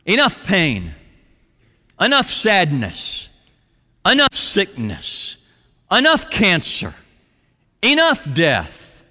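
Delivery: emphatic, drawn-out proclamation; mild shout. Acted. Preacher declaring an end to suffering, mild shout. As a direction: hit each "enough" high, with pauses between. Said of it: "come back with a loud shout".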